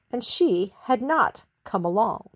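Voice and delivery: plain reading voice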